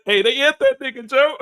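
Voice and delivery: high, loud excited blurt; excited news. Retold or imitated. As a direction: burst out with the news. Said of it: "he's like".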